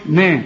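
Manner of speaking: نا thin and fronted, toward "nae"; light n. Shown as a demonstration. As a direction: demonstrate a light نا. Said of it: "كل نون في القرآن مرققة". (نون مرققة في نا)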